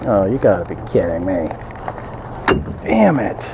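ordinary delivery

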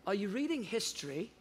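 lightly imitated British accent, softly curious question. Seatmate asking about the Bible he reads, British accent. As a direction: put on a refined British English accent. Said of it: "in a perfect British accent".

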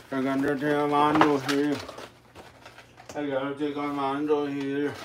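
put-on Mexican Spanish accent, joking; playful Mexican accent imitation; mock Mexican accent. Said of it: "That's how the Mexican people really talk".

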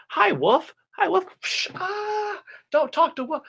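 put-on very high, girlish sing-song voice. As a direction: chirp like a little girl greeting the wolf. Girl greeting the wolf.

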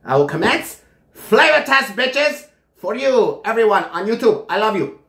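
high, projected, drawn-out showman delivery; mock-grand announcement. Reviewer announcing flavor test to YouTube viewers, high mock-grand proclamation.

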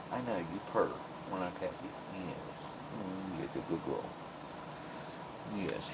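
soft, low cooing to a cat; soft pet talk. Real, not acted. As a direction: coo gently to your cat. Petting cat, praising her.

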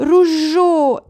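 high, loud gurgling cry; gurgled cry. A narrator's reading. Drowning hunter gurgles a cry for his gun. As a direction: cry out while half underwater. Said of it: "булькнув він".

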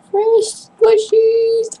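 high, slow squeal of joy; delighted high cry; gleeful squeal. Real, not acted. Unboxing surprise.

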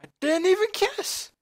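high, loud indignant yelp; shocked outcry. Real, not acted. Yelping at the game for failing before any kiss. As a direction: cry out in outrage. Outburst at the game's failure.

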